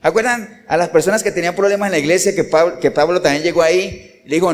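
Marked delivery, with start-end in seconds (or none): none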